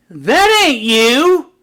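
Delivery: high, loud, drawn-out heckle; little girl. Retold or imitated. Little girl challenging the guest speaker before her whole school, high loud disbelief. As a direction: mimic a kid's loud, slow challenge. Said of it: "this little girl stands up".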